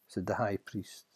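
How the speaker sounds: ordinary delivery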